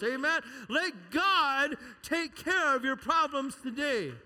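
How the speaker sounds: rally the church with each amen; preaching at full force. Acted. Pastor driving home the sermon's point, loud preaching call.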